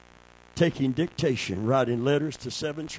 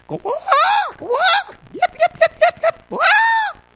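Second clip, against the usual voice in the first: very high shouted quacks and yips, slowing at the end; birdlike shepherd call; 9 dB louder, much slower than usual. Retold or imitated. Preacher imitating a shepherd's birdlike call, high shouted quacks. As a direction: squawk it out like a bird. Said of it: "Sound like a bird, don't it?".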